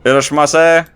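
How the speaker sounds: cheerful store clerk. Pretend. Cheerful clerk greeting at night.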